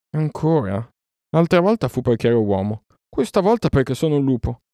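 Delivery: a touch of indignant disbelief; indignant retort. A narrator's reading. Mowgli rejected as a wolf, indignant.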